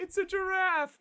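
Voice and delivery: proudly and wrongly announce it; dumb Patrick. Retold or imitated. Co-host echoing Patrick's wrong guess, dopey high cry. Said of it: "stupid Patrick goes".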